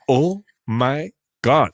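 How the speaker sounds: slow, drawn-out awe; awed retelling. Retold or imitated. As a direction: stretch it out in wonder. Retelling awe after vision.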